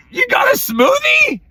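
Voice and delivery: dad shocked at son's smoothie; outraged disbelief. Retold or imitated. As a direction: squeal it in disbelief. Dad catches sick son.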